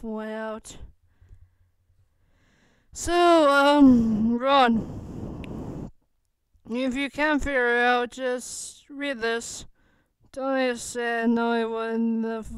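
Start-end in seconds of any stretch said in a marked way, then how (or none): 2.9-9.7 calling out loud. Acted. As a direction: call out to Ron, then drop and drag out the end. Tony shouts instructions to Ron.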